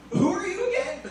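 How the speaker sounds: high, spacey question; high, dazed mock-stoned mom. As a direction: float it up high like she's baked. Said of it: "And she goes".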